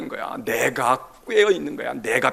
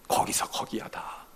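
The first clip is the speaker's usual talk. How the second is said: hushed aside; 8 dB quieter than usual. Acted.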